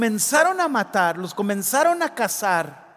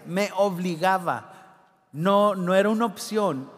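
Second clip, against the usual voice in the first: ordinary talk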